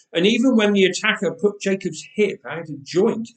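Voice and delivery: ordinary delivery, nothing marked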